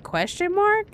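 high, incredulous questioning lift; retold reply in exaggerated disbelief; mock bafflement. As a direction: pitch it right up as if baffled. Acts out baffled reply to accusation.

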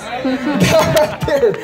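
slow words through a laugh; amused, laughing aside. Real, not acted. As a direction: chuckle as you say it. Laughs after his own scream.

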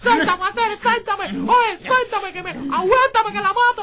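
very loud, high screaming rage; furious struggling. Acted. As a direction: yell as if fighting off whoever holds you. Forcejea furioso, sujetado por amigos. (gritos furiosos, forcejeando)

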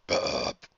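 low rumbling spoken burp; deep belch. Acted. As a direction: let out a big belch. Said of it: "let out a large belch".

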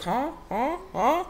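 high-pitched puzzled huhs; short, high questioning huh; imitating confused friends. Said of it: "it's like they be like".

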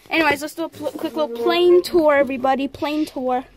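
high, loud tour-guide hype; excited announcement. Real, not acted. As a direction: pump up the viewers.

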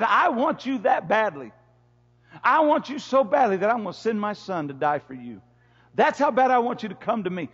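loud, high, impassioned preaching. Acted. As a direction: preach it out full force to the church. Preaching at full force.

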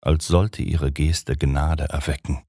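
usual speaking voice

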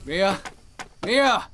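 name called high, rising in urgency; alarmed calling. Acted. Man calling his lost radio contact, alarmed. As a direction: call out as the connection slips away. Calls her after signal loss.

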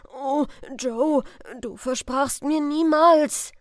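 loud wounded appeal; pleading cry. Acted. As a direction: cry out to Joe in panic. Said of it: "rief aus".

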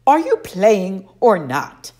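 slow, loud, irritated demand; impatient. A narrator's reading. Camp director impatiently prodding Grover, slow and loud. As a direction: drag it out, fed up with waiting. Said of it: "Mister D said impatiently".

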